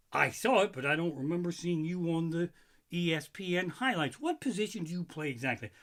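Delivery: usual speaking voice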